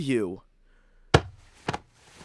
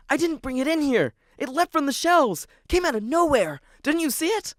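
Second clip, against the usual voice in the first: pleading protest; 8 semitones higher than usual. Acted. Protesting to the librarian.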